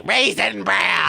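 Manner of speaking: slow, drawn-out dopey voice; mock Bobby voice. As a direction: stretch it out like a kid. Voicing Bobby.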